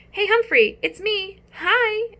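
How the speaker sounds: put-on young boy greeting the class hamster; excited boy shout. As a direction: call out gladly to a pet. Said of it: "a familiar voice shouted".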